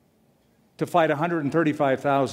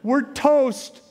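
high, alarmed comic cry of panic; panicky servant; 10 semitones higher, much slower than usual. Retold or imitated. A frightened servant sees the enemy army, high mock panic. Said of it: "Bible talk for".

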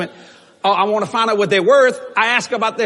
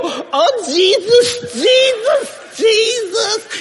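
shouting praise; 13 semitones higher, much slower than usual. Retold or imitated. Church folk shouting when the clouds are there, high cries. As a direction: cry out Jesus, building joy. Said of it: "they go".

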